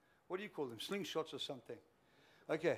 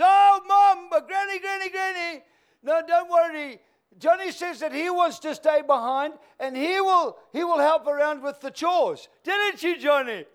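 frantic pleading; 11 semitones higher, 16 dB louder than usual. Acted. Sally frantically covers to Granny.

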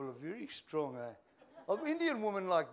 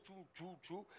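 catapult noises; 14 dB quieter than usual. Retold or imitated. Catapult shots imitated.

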